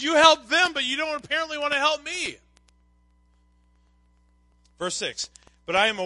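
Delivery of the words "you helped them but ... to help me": higher voice, loud at the start; aggrieved complaint. Retold or imitated. Psalmist complains God helped the fathers, aggrieved. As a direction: protest like one left out.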